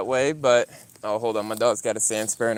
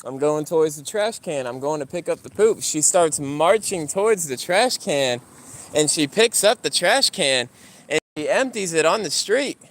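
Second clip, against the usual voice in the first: ordinary delivery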